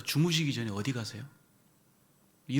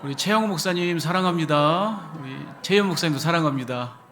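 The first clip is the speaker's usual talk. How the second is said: loud shout; 5 semitones higher, 9 dB louder than usual. Shown as a demonstration. Calling love to the pastors, loud high shout. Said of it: "큰 소리로".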